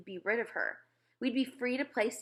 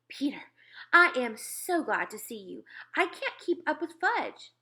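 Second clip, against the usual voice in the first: voiced mother, rising to a high plea; relieved, harried mother; 6 semitones higher than usual. A narrator's reading. Mother who can't keep up with Fudge, relieved and harried. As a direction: greet your son with worn-out relief.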